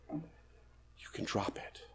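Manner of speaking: soft slow whisper; hushed slow whisper. Acted. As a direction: breathe it out as release. Gentle release in sermon.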